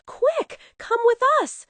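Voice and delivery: very high, hurried urging; donkey urging quickly; urgent call. Acted. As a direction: hurry them along. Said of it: "said Donkey".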